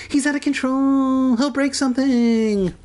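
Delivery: high, wailing mock panic; hammed-up frantic character cry. A narrator's reading. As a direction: wail a warning in a squeaky voice. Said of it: "Kermit cried".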